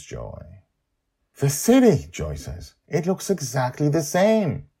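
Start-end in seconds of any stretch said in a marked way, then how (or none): 1.4-2.1 put-on high, eager girl's voice; a young girl thrilled at what she sees; excited child Joy. Joy spots the cloud city, high and thrilled. 2.9-4.7 put-on high, eager girl's voice; a young girl thrilled at what she sees; excited child Joy. Joy spots the cloud city, high and thrilled.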